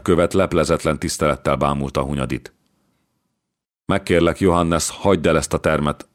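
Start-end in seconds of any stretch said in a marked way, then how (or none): none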